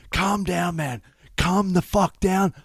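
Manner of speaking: high-pitched frantic plea; urgent calming. Retold or imitated. Friend grabbing him to stop the outburst, urgent. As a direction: push it high, begging him to settle.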